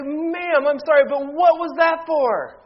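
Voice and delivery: high, loud, shocked question; stunned protest. Acted. To the pharmacist who slapped him, shocked and high-pitched. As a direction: act floored by the slap.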